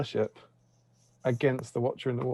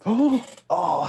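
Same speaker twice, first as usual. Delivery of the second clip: surprised gasp; 7 semitones higher, 6 dB louder than usual. Real, not acted. Near miss on dice roll.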